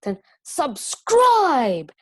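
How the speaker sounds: slow, loud, high stress on subscribe; emphasized words. Shown as a demonstration. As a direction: lean hard on subscribe and stretch it out. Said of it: "if I put it in the exclamation marks".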